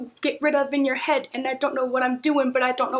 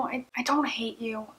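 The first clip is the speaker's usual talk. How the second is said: soft unvoiced reassurance; whispering; 2 semitones lower, 8 dB quieter than usual. Real, not acted. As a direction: whisper it gently.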